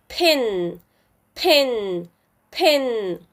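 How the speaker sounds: "pin" with a short "ih". Shown as a demonstration. Teacher blends a C V C word, short I. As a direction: say "pin" slowly apart, vowel as in "ih". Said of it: "the short I sound".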